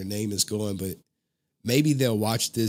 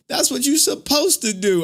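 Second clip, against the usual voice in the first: loud, much higher imitation; scolding grandpa; 7 dB louder than usual. Grandpa scolding grandson over straight A's, loud high voice. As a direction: mimic an indignant old man's telling-off.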